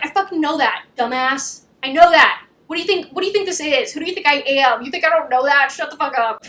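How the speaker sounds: annoyed, rapid-fire retort; mock angry. Her snapping at him as he panics, mock anger. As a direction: imitate an exasperated comeback. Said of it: "I like that she's angry".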